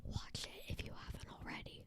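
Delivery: soft unvoiced whisper. Real, not acted. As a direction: whisper it to the audience. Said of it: "if you couldn't hear me say".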